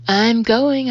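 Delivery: yelling back at Guy on the stairs. A narrator's reading. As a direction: throw it back over your shoulder, loud. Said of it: "I yell back".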